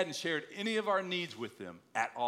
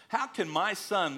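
usual speaking voice

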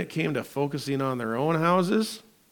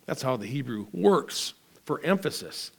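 ordinary talk, nothing marked

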